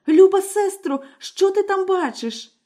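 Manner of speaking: high, calling anxiously up the tower; frightened wife begging for news; anxious young wife. Acted. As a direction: call out to her sister, desperate. Wife calls to sister.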